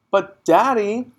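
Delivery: high, slow, loud plea; girl begging her father; pleading child. Acted. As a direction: draw out the plea to daddy. Matilda began.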